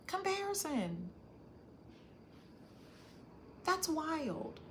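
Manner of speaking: high, stunned realization. Real, not acted. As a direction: hit by the realization. Realizing her own pattern.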